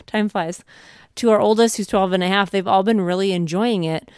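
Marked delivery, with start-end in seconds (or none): none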